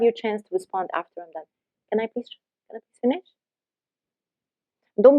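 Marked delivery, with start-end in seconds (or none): 1.9-3.3 quiet polite plea, rising on finish; soft and low. Shown as a demonstration. To the one talking over you, soft asking. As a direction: ask softly to be allowed to finish. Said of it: "with a soft and low voice".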